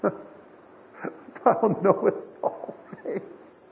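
halting, voiceless, choked-up delivery; broken Peter. Acted. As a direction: force it out very slowly, voice giving way. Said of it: "listen to the tone there".

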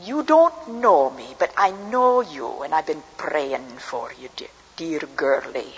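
put-on slow, light Scottish accent of an old lady. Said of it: "She had a Scottish accent".

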